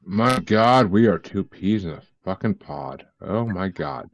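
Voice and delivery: ordinary talk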